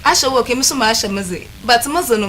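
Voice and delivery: ordinary talk, nothing marked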